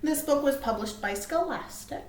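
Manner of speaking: plain reading voice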